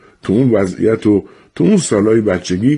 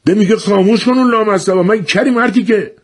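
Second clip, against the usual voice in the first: furious shout; 14 semitones higher than usual. Acted. Angry man in the crowd yelling at the lighter holder, high furious shout. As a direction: blow up at the man who won't listen. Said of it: "یهو یکی داد زد".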